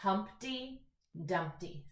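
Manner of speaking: teacher spacing out the two title words; syllable-by-syllable reading. Shown as a demonstration. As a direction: split "Humpty" and "Dumpty" with a pause between. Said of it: "Two words in our title".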